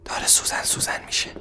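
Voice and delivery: soft unvoiced whisper of "سوزن سوزن". Repeated line, whispered take.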